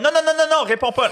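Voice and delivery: high, urgent no no no; panicked refusal. Retold or imitated. Landlady forbids answering the door. (refus paniqué, voix aiguë)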